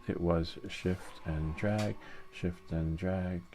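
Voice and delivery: slow, spelled-out shift and drag. Shown as a demonstration. Reminding viewers of the slicer shortcut, slow and deliberate. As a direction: drag out "shift and drag" word by word, slowly. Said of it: "And remember".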